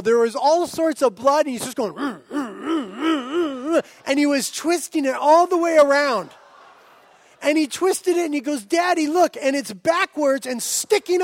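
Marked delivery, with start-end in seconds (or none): 1.9-3.8 straining hums. Retold or imitated. Son straining to pull tooth. 8.6-9.4 put-on excited kid. Son shows twisted tooth.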